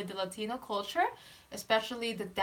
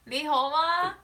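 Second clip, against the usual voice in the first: excited; 6 semitones higher, 6 dB louder than usual. Real, not acted. Excited reply in Cantonese.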